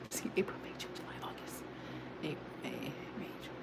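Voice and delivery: counting months under her breath; soft muttered count on fingers; whispered counting. Real, not acted.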